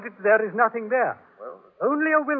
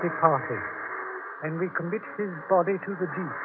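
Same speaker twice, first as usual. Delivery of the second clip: solemn burial service reading; hushed graveside prayer; 3 semitones lower than usual. Acted. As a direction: read the sea burial rite solemnly. Captain reads burial at sea.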